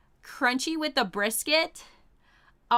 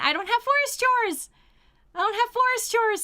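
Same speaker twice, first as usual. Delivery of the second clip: high, sing-song cheer; gleeful celebration; 13 semitones higher, 4 dB louder than usual. Real, not acted. Streamer celebrating a weekend without forest chores, gleeful celebration. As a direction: squeal it out twice, giddy with relief.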